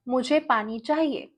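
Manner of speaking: rattled off fast in Hindi; rapid Hindi. Shown as a demonstration. Teacher showing a learner thinking in Hindi, fast. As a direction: blurt the Hindi thought quickly. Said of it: "You think in Hindi".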